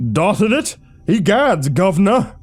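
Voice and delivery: put-on hammy high Cockney accent, loud; over-the-top Cockney exclamation. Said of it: "now you're doing Cockney?".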